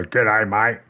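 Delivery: mock attempt at an Australian accent; shitty Australian accent. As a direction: imitate someone botching an Australian greeting. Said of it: "try to do a shitty Australian accent".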